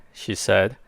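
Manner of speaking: plain reading voice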